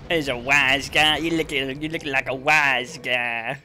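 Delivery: put-on slow Sopranos-style American accent; American wise-guy accent. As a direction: drawl it in a mobster's American accent.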